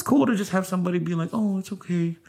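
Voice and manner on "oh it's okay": consoling. Retold or imitated. Consoling someone sad.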